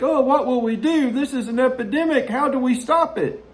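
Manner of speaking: high-pitched hand-wringing parody; mock alarm. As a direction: wail it in fake helpless dismay. Mocks panicked public reaction to epidemics.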